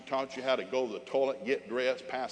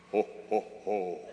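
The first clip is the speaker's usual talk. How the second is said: low, mock jolly ho-ho-ho; put-on Father Christmas laugh; Santa laugh; 5 semitones lower than usual. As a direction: play Santa with deep separate hos. Santa laugh after Merry Christmas.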